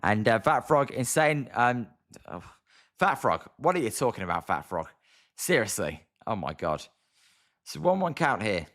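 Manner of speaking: ordinary delivery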